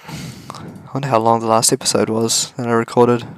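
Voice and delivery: ordinary talk, nothing marked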